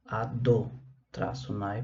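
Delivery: "Ado, traço Nai", a gap before "traço Nai"; broken-up divine name. Shown as a demonstration. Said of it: "pra gente não pronunciar o nome".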